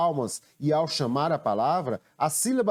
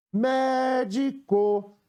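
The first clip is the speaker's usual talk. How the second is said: "médico" called slowly, MÉ held long and high; "médico" as a sustained, much higher call; calling out the word; 9 semitones higher, much slower than usual. Shown as a demonstration. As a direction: hail "médico", stretching the first syllable. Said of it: "chamando a palavra".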